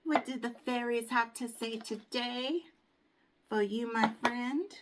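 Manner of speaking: sing-song what do the fairies say; playful sing-song question. Acted. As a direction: tease the question like a little secret. Teasing fairy card question.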